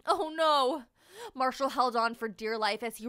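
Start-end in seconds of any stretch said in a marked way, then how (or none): 0.1-0.8 higher, louder "oh no"; alarmed cry. Acted. Marshall in danger.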